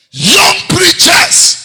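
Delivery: very slow, high shouted lament; anguished cry. Acted. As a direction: wail it out slowly. Mourning young preachers' deaths.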